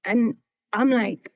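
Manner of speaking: ordinary talk, nothing marked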